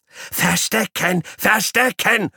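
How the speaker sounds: very high squealing call; squeaky guinea pig call. Acted. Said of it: "ruft Paule schon wieder".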